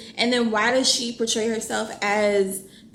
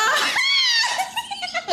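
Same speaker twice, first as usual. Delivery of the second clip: shrieking laugh. Real, not acted. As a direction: burst into a shrieking cackle. Erupts at the book.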